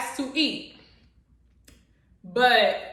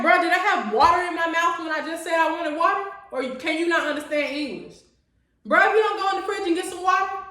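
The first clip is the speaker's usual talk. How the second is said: higher-pitched, fast sarcastic rant; exasperated; 5 semitones higher, faster than usual. Retold or imitated. Woman retells wanting only water from her date, exasperated. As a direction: fire off incredulous questions, mock-indignant.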